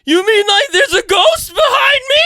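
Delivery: high, loud cartoon panic; over-the-top scared cartoon shriek; Shaggy. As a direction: cry out in a squeaky, terrified wail. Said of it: "turning to you now for a shaggy".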